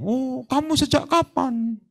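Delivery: high, soft, slow mock friendly question to a demon; sweetly chatting with the devil; soft mock chat. Mimicking a weak preacher sweetly asking the demon. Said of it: "sama setan dia ngomong".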